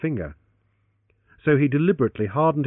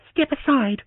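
angry; 12 semitones higher, 4 dB louder than usual. Acted. Heroine still furious.

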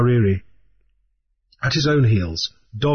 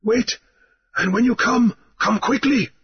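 high, urgent caution; high, urgent warning; 11 semitones higher than usual. A narrator's reading. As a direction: warn him to be quick. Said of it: "Mariri cautioned".

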